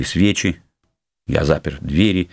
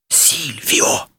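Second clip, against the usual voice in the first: startled cry; 7 semitones higher than usual. A narrator's reading. Count recognizing his old foe, startled cry. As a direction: cry the name in a stifled gasp. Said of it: "закричал я".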